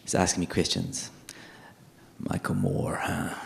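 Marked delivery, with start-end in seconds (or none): 2.2-3.3 dropped low and dry, knowing suspicion; suspicious officer. Retold or imitated. Interviewing officer eyeing him, low and suspicious. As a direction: lower your voice like an official who has read your file.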